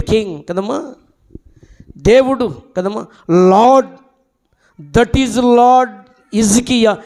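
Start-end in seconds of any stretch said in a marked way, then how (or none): none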